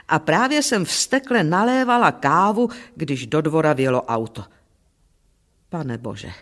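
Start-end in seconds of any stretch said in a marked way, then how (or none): 5.7-6.3 low, soft plea to God; muttered prayer. A narrator's reading. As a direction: sink into a quiet, despairing aside. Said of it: "modlila jsem se".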